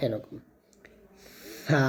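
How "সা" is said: সা with the tongue touching the upper teeth tips, vowel drawn out. Shown as a demonstration. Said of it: "সা বলার সময় এক লিফ টান দিতে হবে". (জিহ্বা উপরের দাঁতের আগায় লাগিয়ে টেনে সা)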